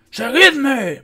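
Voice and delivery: high, loud rallying roar; commander's high battle cry; bellowing. A narrator's reading. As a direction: bellow to make the men follow. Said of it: "bramó, arrancando el estandarte".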